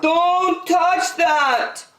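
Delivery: high, drawn-out warning shout; alarmed yell. Acted. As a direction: yell a slow, urgent warning. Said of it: "Her father yelled".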